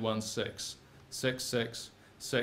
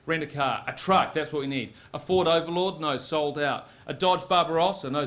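ordinary delivery

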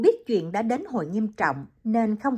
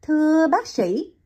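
puzzled; 8 semitones higher, 5 dB louder, slower than usual. Acted. Liễu ngơ ngác hỏi lại.

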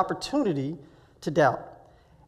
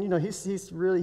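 ordinary talk

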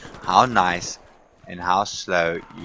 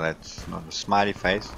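ordinary talk, nothing marked

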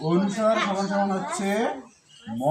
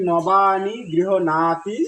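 slow recitation; much slower than usual. Shown as a demonstration. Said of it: "শুনতে হবে নর".

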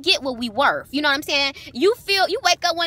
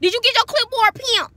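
higher, sing-song imitation of the coworker; hammed-up coworker impression; mocking coworker voice; 9 semitones higher than usual. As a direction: mimic the new girl's greeting, a bit higher. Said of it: "She was like".

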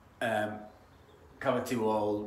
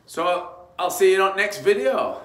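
cheery sign-off; 9 semitones higher, 11 dB louder, slower than usual. Real, not acted.

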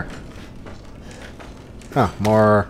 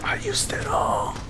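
whisper; 10 dB quieter than usual. Real, not acted. Out of ammo, whispered.

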